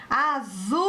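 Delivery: "Azul" called out, "zul" held very long; calling the word. Shown as a demonstration. Teacher calls the word to confirm the stressed syllable, calling out. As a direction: call "Azul", stretching the last syllable. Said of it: "Vamos chamar a palavra azul".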